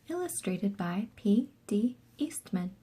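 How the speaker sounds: hushed whispered credit line. Acted. Reading the cover credits to a child, whispered. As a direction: keep it a soft whisper.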